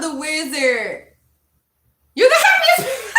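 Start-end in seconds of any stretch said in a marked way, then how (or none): 2.1-3.2 high, loud laughing shout; laughing squeal. Real, not acted. Streamer squealing with laughter at the chat. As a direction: burst out laughing with joy.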